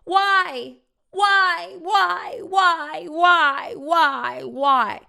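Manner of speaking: high, loud repeated yell, easing off at the end; a woman in a new voice, shrieking on tape. Retold or imitated. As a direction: scream each why, then let it fade.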